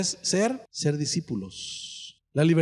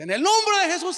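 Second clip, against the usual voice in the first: high, shouting rebuke; over-the-top exorcism cry; mock shouted prayer; 12 semitones higher, 3 dB louder than usual. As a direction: yell it into her ear. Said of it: "y le dice".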